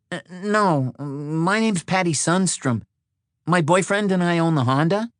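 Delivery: high-voiced Patty-like woman caller; young woman on the phone. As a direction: raise the voice into a woman introducing herself.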